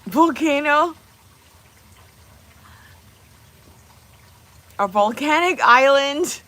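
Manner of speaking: high, loud, stretched-out questioning; incredulous. Real, not acted. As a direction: react with loud, high-pitched disbelief. Surprised question at an idea.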